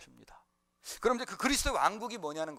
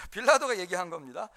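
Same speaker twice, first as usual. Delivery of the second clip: ordinary talk, nothing marked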